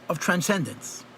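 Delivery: ordinary delivery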